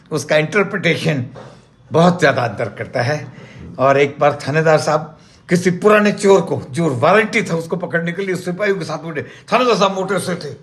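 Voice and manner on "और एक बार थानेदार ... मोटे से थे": ordinary delivery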